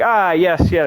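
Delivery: mock the lofty old professor; imitating the professor. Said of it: "that's how he talked".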